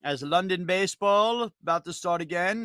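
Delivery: loud, hammed-up British accent, pitched high; mock British accent. Host opening the London game show, in a hammy British accent. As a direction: play a silly London Brit greeting the listeners. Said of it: "stop that stupid, stupid accent I was doing".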